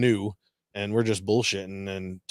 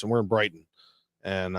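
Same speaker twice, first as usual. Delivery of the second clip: normal talking voice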